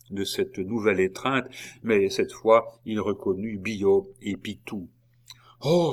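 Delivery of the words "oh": high narrated cry of "oh"; exclamation. A narrator's reading. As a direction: exclaim it in character. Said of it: "s'écria Gilbert".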